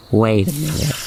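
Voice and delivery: plain reading voice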